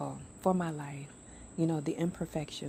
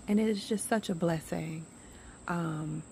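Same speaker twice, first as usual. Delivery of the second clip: usual speaking voice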